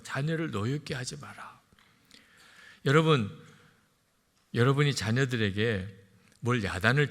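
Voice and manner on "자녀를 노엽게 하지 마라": whispered, the word 자녀를 leaning in; hushed verse. Shown as a demonstration. Preacher whispering the verse to the parents in the pews. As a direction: breathe the command softly to the parents. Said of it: "자, 부모 되신 분들은 잘 들으세요".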